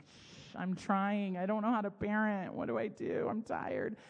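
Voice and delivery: lightly acted overwhelmed parent; mock exasperated mom. Mom admitting to her kids she can't parent, mock exasperation. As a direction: play up the helpless parent a little.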